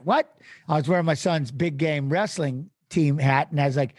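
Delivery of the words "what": 1 surprised what. Retold or imitated. Retold disbelief at sponsor request.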